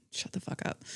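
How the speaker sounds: breathy whisper, almost no voice; whispered retort. Retold or imitated. Vlogger answering critics, whispered put-down. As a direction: hiss it under your breath.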